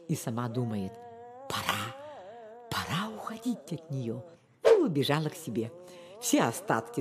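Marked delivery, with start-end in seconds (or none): 1.5-4.2 thinking to oneself. A narrator's reading. Mouse secretly deciding to leave the fox, hushed. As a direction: say it softly, as a private thought.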